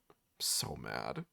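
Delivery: real seething whisper; angry whisper. Real, not acted. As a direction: hiss it under your breath, fuming. Seething after losing willpower.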